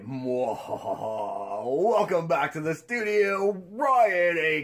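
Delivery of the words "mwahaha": quieter, slower evil laugh; hushed villain chuckle; mad scientist laugh. Pretend. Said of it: "Or should I say".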